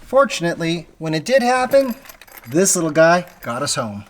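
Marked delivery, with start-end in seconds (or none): none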